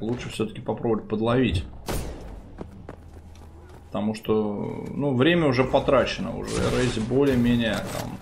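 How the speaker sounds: ordinary talk, nothing marked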